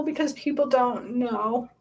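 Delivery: fighting back tears as the pitch climbs; getting emotional. Real, not acted. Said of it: "I'm getting emotional".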